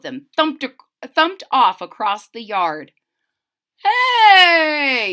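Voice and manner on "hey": sharp high shout; yell. A narrator's reading. The paper boy yells at the thieving bunnies. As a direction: shout to stop a thief. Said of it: "yelled the boy".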